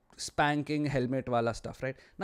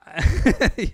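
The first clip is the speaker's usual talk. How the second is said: laugh; 6 semitones higher, 10 dB louder than usual. Real, not acted. Laughing at chat.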